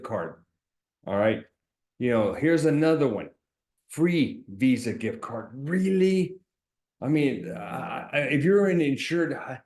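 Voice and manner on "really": high, incredulous "really?"; sarcastic disbelief. Real, not acted. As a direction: scoff at the ad in mock disbelief. Mocking scam gift card ad.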